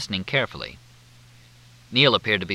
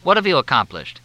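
blunt, louder demand; like a sledgehammer; 6 semitones higher, 7 dB louder than usual. A narrator's reading. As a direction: hammer the question home. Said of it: "like a sledgehammer".

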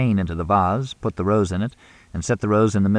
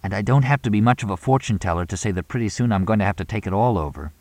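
usual speaking voice

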